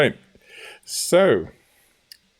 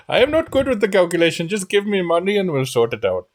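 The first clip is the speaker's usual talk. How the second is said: put-on Indian accent; 7 semitones higher, faster than usual. Merchant haggling, offensive Indian accent.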